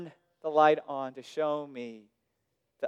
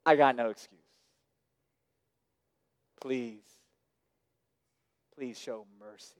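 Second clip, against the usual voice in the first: pleading confession; 5 semitones lower, 5 dB quieter than usual. Retold or imitated. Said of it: "Repentance means".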